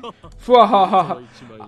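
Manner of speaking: big booming mad scientist cackle; loud maniacal laugh. Acted. Scheming to turn the toy into research money, loud maniacal laugh. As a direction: throw your head back and cackle.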